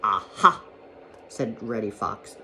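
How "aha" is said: an exclamation of gotcha, jumping up in pitch; triumphant aha. A narrator's reading. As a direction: pounce on it with sly glee. Fox springs up with a scheme.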